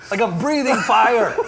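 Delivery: loud, high, half-laughing outcry; alarmed exclamation. Real, not acted. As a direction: exclaim through the burn. Overwhelmed by hot sauce burn.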